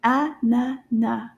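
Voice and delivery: "A-na-na" slowly in three separate beats; syllable by syllable. Shown as a demonstration. Teacher confirming three syllables, "A-na-na" in separate slow beats. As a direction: break "A-na-na" evenly after each syllable. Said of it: "Trois syllabes".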